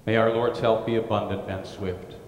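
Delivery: ordinary talk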